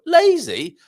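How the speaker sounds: high, loud incredulous "Lazy"; mock indignation. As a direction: throw "Lazy" back as an outraged question. Mock protest at being called lazy.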